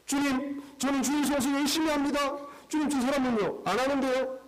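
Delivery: high, pleading complaint about others; whiny disciple. Acted. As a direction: tattle on the others to the Lord, high and eager. Disciple whining to Jesus in skit.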